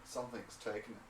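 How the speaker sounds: soft, grave pronouncement; hushed. Acted. Man revealing what his ritual showed, hushed. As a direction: say it quietly after a long pause.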